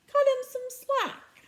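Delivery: a teammate voiced high and drawn out; high pleading teammate. A narrator's reading. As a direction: stretch it out high and appealing. Aaron defends narrator, voiced high.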